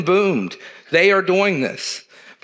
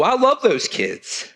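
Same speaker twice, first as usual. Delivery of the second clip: holding back tears; 3 semitones higher, slower than usual. Real, not acted. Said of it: "I get emotional about this".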